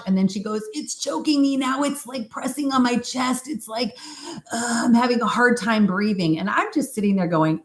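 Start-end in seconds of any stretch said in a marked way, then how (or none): 0.7-6.4 high-pitched retold panic; imitating distressed client. Client tells practitioner her chest is pressed, mock distress. Client struggling to breathe, acted.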